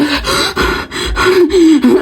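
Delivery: put-on gasping breaths; fake panic attack. Shown as a demonstration. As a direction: pretend to hyperventilate. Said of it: "pretend to have a panic attack".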